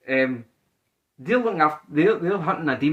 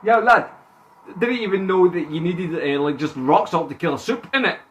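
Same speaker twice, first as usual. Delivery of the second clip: bad English accent; 2 semitones higher than usual. Friend trying Butcher's line to Sam, bad English accent. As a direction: hit the English lad slang, accent badly faked. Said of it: "how the fuck do you do the English accent?".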